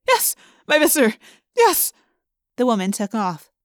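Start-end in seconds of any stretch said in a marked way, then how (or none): 0.1-1.9 high, loud, panicky yes; frightened obedience. A narrator's reading. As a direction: answer a feared master in a panic. Terrified woman obeys the Visser.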